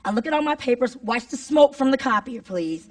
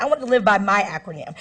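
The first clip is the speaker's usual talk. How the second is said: ordinary delivery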